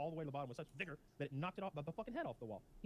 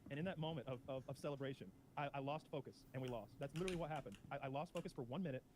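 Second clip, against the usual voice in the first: ordinary talk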